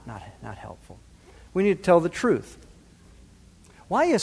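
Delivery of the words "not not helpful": much softer, almost under the breath; near-whispered dismissal. Real, not acted. Preacher repeats himself, fading to a hush. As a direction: let not helpful fade away.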